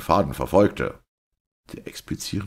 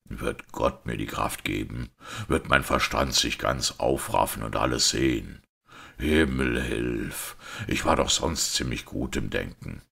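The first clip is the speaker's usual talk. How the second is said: lower-pitched priest straining to think; anguished priest; 3 semitones lower than usual. Acted. Priest begging for a minute to see the answer, anguished. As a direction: play the priest wrestling with his mind.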